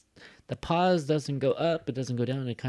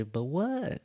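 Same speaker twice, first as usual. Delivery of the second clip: "what" swooping up high; prompting question; 4 semitones higher than usual. Shown as a demonstration. Teacher shows the listener's expected reply, rising question. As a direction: ask for the rest, rising on "what". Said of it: "you're supposed to say".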